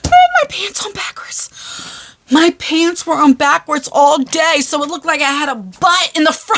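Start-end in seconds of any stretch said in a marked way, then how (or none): 0.1-1.5 breathless whisper through laughter; whispered confession. Real, not acted. Confessing to viewers, a breathless whisper. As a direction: whisper it as if too embarrassed.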